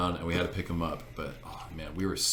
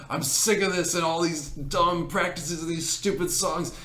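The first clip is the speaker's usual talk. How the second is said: mock rant; 10 semitones higher than usual. Classmate's rant retold.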